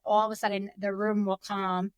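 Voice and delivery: ordinary talk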